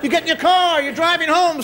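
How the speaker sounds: ordinary talk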